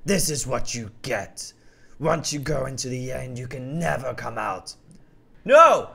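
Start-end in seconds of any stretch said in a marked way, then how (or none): 0.0-4.7 slow, low menacing lion; drawn-out cruel gloating; lion tells the hero his cow is doomed; villain lion voice. Acted.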